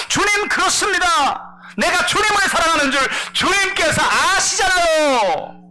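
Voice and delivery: high, loud, slow heartfelt declaration; impassioned reply. Acted. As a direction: pour out his love in a loud cry. Peter answers Jesus passionately. (높고 크게 절절히 외치는 고백)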